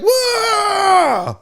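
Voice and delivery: mock fan roar; comic imitating a hyped crowd; crowd cheer. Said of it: "They're all like".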